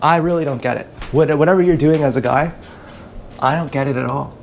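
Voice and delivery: ordinary talk, nothing marked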